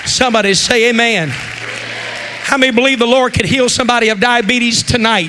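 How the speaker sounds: rally the room; loud preaching call. Real, not acted. Preacher rallying the congregation to believe, loud.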